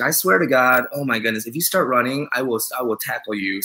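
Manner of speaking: ordinary talk, nothing marked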